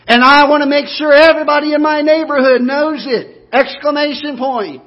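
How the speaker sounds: loud, high ringing proclamation; exclaimed. Shown as a demonstration. Preacher exclaims wanting his neighborhood to know God, loud and high. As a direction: declare it to the whole county.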